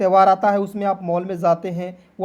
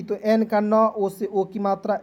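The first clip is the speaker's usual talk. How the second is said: ordinary talk, nothing marked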